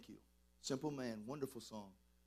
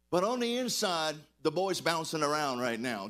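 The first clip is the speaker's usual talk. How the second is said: louder, bursting with excitement; thrilled preacher, much louder; excited; 8 dB louder than usual. Real, not acted. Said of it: "I'm getting excited about this".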